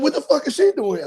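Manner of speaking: shocked high yell. Retold or imitated. Friend asking in shock.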